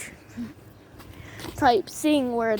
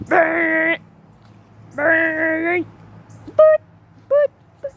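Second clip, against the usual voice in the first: imitated alarm tone; 4 semitones higher, 5 dB louder, slower than usual. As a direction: mimic an emergency alert test beeping. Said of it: "like that".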